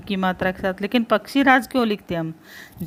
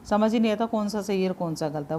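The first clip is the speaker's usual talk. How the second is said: ordinary talk, nothing marked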